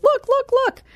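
high squeaky excited imitation; imitating Mikey showing off his lunch photos; mock excited friend. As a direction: squeal it like a proud kid showing off. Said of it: "And he goes".

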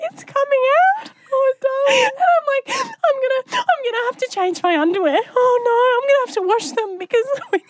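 high, frantic mock distress; playing up a pretend accident; fake panic.

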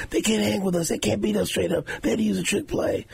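high, fired-up rallying cry; imitating Chris Jones firing up his team. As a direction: pump up the team in the huddle.

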